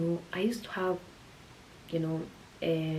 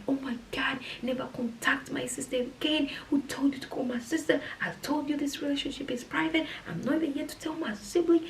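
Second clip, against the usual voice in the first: imitating angry boyfriend; 6 semitones higher, faster than usual. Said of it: "He was so mad".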